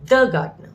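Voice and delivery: emphatic "the", falling on "gardener"; stress on the. Shown as a demonstration. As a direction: hit "the" to single that one out. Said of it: "so we'll stress on the now".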